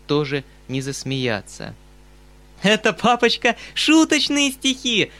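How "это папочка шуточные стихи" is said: much higher, louder gleeful shout; delighted cry. A narrator's reading. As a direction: cry out, thrilled with the prank. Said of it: "вскричала она, ужасно радуясь своей детской затее". (радостный возглас)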